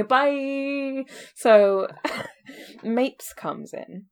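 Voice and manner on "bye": sing-song sign-off. Pretend. Jokey mock sign-off.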